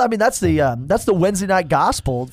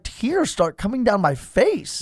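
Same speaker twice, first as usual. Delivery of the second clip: normal talking voice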